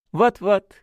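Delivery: high, loud, merry agreement; cheerful. A narrator's reading. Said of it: "весело закивал священник". (весело, высоким голосом)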